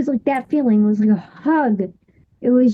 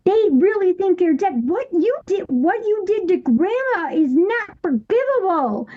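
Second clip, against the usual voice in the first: scolding through tears; 7 semitones higher than usual. Retold or imitated. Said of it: "And I said".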